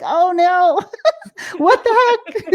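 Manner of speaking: high panicky cry breaking into a laugh; mock panic. Mocking her own body's fear, laughing panic. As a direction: squeal it, half scared and half laughing. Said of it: "but then you're like".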